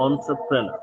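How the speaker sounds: এন্টারপ্রেনার as "ontro-prena"; model pronunciation. Shown as a demonstration. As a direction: say এন্টারপ্রেনার for copying, starting "on". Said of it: "আমার সাথে সাথে সবাই একটু উচ্চারণ করুন".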